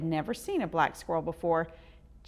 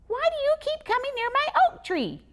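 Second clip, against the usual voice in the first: high, fast, loud squeaky squirrel; 5 dB louder, faster than usual. Acted. Squirrel questioning the boy by his tree, squeaky and fast. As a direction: rattle it off like a cross squirrel.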